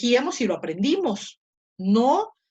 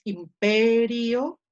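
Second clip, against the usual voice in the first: "imperio" slowed, with PE held long; stress on PE; 5 dB louder, much slower than usual. Shown as a demonstration. Teacher gives the corrected reading, "imperio" with long stressed PE. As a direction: lean on the PE in "imperio" and stretch it. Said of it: "la sílaba tónica es P".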